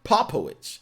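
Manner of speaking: Popowich high, as "POP-o-witch"; practised name pronunciation. Real, not acted. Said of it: "but no, it's Popowich".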